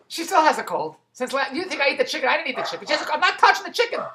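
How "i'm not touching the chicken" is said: ordinary delivery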